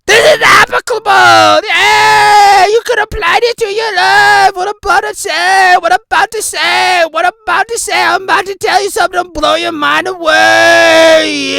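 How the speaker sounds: loud, high show-host hype; shouting intro hype; hype-man shouting. Acted. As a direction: pump the crowd up like a wild host.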